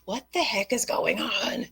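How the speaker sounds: high, slow bewildered question; puzzled and exasperated; mock bewildered. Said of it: "you're like".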